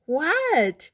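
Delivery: high, loud little-frog reply; calling back. A narrator's reading. Froggy answering his mother's call, calling back.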